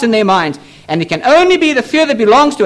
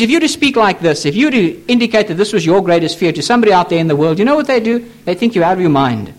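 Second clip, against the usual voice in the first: ordinary talk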